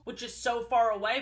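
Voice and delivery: usual speaking voice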